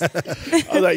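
ordinary delivery

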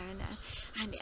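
ordinary delivery

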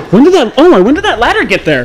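loud, high, startled question; alarmed surprise. Real, not acted. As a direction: blurt it out in shock. Ladder suddenly appears, alarmed.

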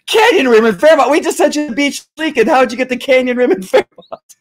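high, incredulous mock dispatcher; baffled dispatcher. Dispatcher baffled at the cop's location, high and incredulous.

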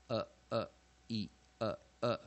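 "呃呃E呃呃" as five short, separate vowels; weak vowel sounds. Shown as a demonstration. Teacher reciting weak A E I O U, "呃呃E呃呃" separately. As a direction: chant "呃呃E呃呃" one detached sound at a time. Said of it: "我们念".

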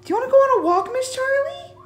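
very high sing-song dog talk. Real, not acted. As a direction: coo it to the puppy.

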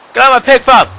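loud, high yell at the mobs; angry shout. Real, not acted. Yelling at monsters raiding his pig farm, loud angry shout. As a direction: bellow them off your farm.

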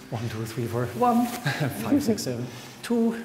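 counting aloud through laughter; laughing while counting. Shown as a demonstration. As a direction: count the beats, giggling between. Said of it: "one of those people who just goes".

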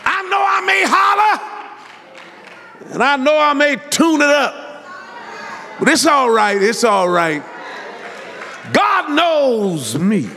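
tune it up and holler to the church; full-force preaching. Acted. Preacher hollering to his congregation about God's freedom.

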